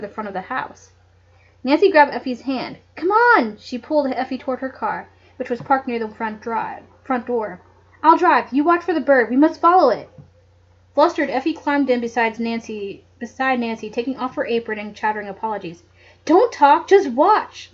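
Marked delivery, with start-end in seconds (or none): 2.9-3.6 high urgent call. Acted. Nancy urges Effie to hurry. 8.0-10.1 loud, brisk orders; commanding. Acted. Nancy takes charge urgently. 16.2-17.7 curt, loud, clipped orders; crisp. Acted. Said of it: "Nancy said crisply".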